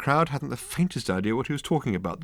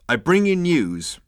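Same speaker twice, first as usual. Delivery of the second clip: grand announcement; 8 semitones higher, 5 dB louder, slower than usual. A narrator's reading. Ford announcing news grandly.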